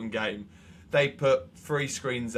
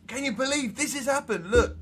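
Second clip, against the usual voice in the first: much higher, alarmed retelling; mock alarmed mate; 11 semitones higher than usual. Mate posting fake news in the group chat, much higher and alarmed. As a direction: play the shocked friend breaking news. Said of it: "he was like".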